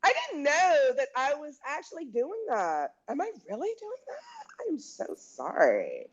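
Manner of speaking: mock innocent sorry at work; fake apologetic office voice. Playing dumb to the boss, lightly mock apologetic. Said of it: "I got my voice and everything down".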